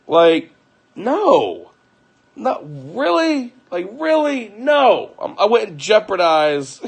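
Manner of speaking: high, loud outraged denial; incredulous outrage. Retold or imitated. Pleading with his wife he'd never risk family, high outraged disbelief. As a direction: protest like the accusation is absurd.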